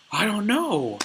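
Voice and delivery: high, loud helpless exclamation; acted flustered protest. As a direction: plead ignorance, hammed-up. Accused character protests, flustered.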